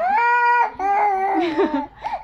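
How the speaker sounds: high, drawn-out laugh; long amused laughter, high up; real laugh. Real, not acted. Amused by the crying child.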